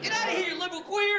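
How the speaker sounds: high, mocking taunt. Kansas bully taunting the comic, high mock sneer.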